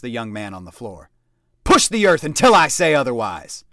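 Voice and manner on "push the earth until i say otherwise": loud command; shouted command. A narrator's reading. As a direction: order him to keep pushing. Sergeant command.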